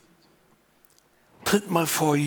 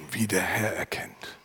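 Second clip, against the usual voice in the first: hushed, lower whispered close; 6 semitones lower, 5 dB quieter than usual. Real, not acted. Preacher tells the church to see as the Lord sees, whispered. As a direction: let it fall to a whisper. Sermon point dropped to whisper.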